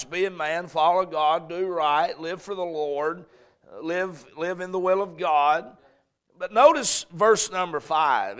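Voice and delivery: ordinary delivery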